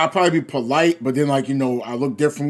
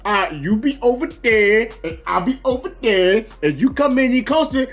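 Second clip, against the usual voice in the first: mock scared; 3 semitones higher than usual. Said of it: "I was like".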